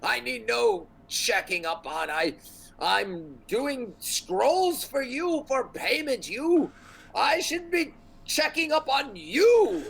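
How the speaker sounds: high, haughty wizard voice; indignant wizard. Acted. Game master voices indignant wizard.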